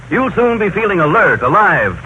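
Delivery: ordinary delivery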